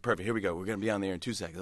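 rushed DJ. Retold or imitated. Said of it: "I was like".

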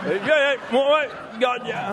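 mock Vermont old-timer brushing you off; cranky button person. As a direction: bark it high and crabby. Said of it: "button person would be like".

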